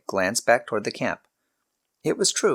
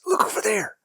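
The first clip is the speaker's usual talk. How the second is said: hushed, higher whispered warning; urgent whisper. A narrator's reading. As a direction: whisper it, pointing out danger. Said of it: "he hissed".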